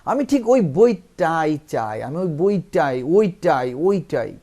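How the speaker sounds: loud, insistent stress on ওই বইটাই; ওই বইটাই punched out loudly, slowly at first; forceful emphasis. Shown as a demonstration. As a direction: hammer each ওইটাই with force. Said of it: "যে জোর দিয়ে বলতেছেন".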